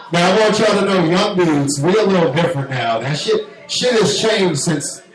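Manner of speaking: ordinary talk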